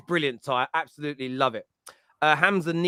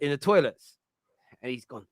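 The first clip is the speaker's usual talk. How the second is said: usual speaking voice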